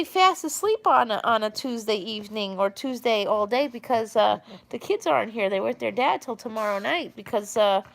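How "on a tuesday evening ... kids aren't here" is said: ordinary talk, nothing marked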